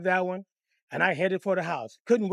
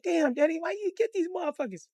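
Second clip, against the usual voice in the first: put-on high little-kid voice; puzzled kid, pitched way up; child questioning Daddy; 10 semitones higher than usual. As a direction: ask it in a small, high voice. Child questions beaten father.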